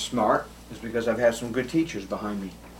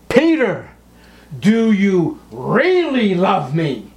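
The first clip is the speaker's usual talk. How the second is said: much higher, louder, slow urgent call; urgent third plea; 8 semitones higher, 6 dB louder, slower than usual. Acted. Jesus asks Peter a third time, slow urgent call. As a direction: draw it out at full force.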